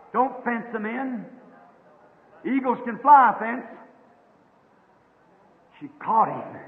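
ordinary talk, nothing marked

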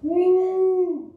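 high, loud joyful whoop; high shouted woo of joy; ecstatic woo. Real, not acted. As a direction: let out a delighted preacher's whoop.

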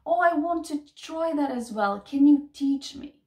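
normal talking voice

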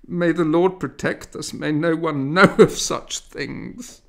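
high, loud wail of fear; frightened matron lamenting on the bench; crying out in dread. Acted. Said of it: "a woman cried out".

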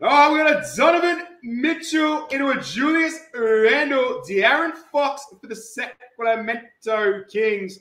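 showy card-break call; hype announcer. Acted. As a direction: announce each player like a line-up.

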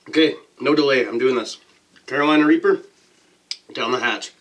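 loud, psyched-up announcement; hyped up. Real, not acted. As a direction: pump yourself up and go for it. Psyching up to eat pepper.

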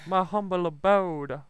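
high, slow put-on voice; mock grand voice. Streamer to viewers, showing off his building in a high mock voice. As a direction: slowly announce the humble abode in a high voice.

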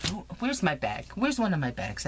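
usual speaking voice

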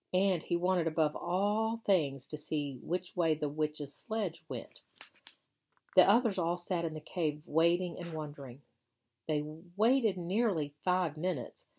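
usual speaking voice

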